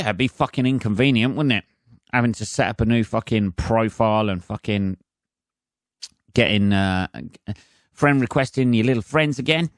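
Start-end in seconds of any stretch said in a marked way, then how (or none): none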